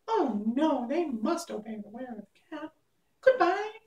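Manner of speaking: very high squeaky mouse queen; squeaky little voice. A narrator's reading. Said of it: "asked in her squeaky little voice".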